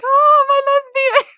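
very high, drawn-out mock squeal; hammed-up high, loud delight; imitated gushing greeting. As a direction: squeal it slowly, greeting loved ones. Voicing Gwen greeting the couple.

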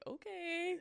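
high, drawn-out okay; sarcastic okay; mock okay. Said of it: "when you just act like".

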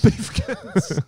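laughing through the words; chuckling while saying it. Real, not acted. Repeating the misheard word, laughing.

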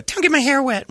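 high whiny put-on voice; hammed-up fussy girl; mock girly whine. Mimicking women at pool.

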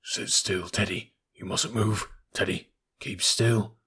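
father warning his son not to move; whisper. A narrator's reading. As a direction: barely breathe the words. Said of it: "all his father could do was to whisper".

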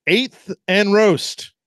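very slow, drawn-out sponsor name; practised ad read. Pretend. Cohost rehearsing the sponsor name slowly. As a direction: stretch each word like a radio spot. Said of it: "I gotta practice saying this".

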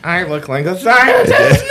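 hammed-up yell of delusion; mock deluded patient. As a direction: blurt it like a proud madman. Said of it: "And you're like".

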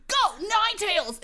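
high-pitched trainer's command; battle yell. Acted. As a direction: yell it like sending out a monster. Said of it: "who's yelling this".

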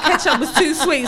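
usual speaking voice

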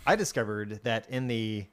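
ordinary talk

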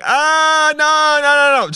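high, loud hammed-up scream of no; mock scream. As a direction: shriek it in fake panic. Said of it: "she just starts screaming".

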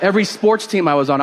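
usual speaking voice

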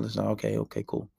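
low, soft and offhand brush-off; nonchalant. Retold or imitated. Brushing off his girlfriend's question with mock indifference, quiet and low. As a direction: shrug her off quietly like it doesn't matter. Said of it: "you be nonchalant about it".

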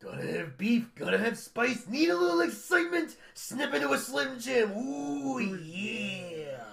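high, loud raspy Macho Man promo; building to a loud, high wrestling-promo yell; Macho Man impression. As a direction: ham up the Slim Jim ad as the Macho Man. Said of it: "Watch and learn".

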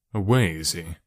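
low, let-down question; mildly crestfallen; disappointed. A narrator's reading. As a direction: drop the pitch with understated disappointment. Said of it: "in a disappointed voice".

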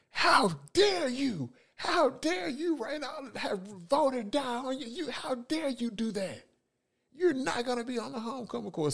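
clutch your pearls and scold; outraged principal. Retold or imitated.

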